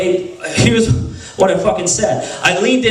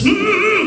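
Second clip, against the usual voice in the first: mock scared whimper; 9 semitones higher than usual. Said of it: "really scared to death".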